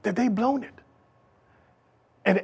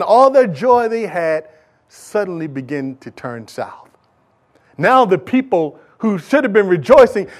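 usual speaking voice

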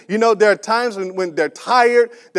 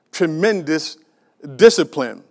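ordinary delivery

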